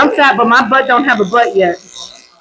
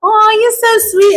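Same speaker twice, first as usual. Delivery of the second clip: sweet cooing; 9 semitones higher than usual. Retold or imitated. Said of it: "I'm like".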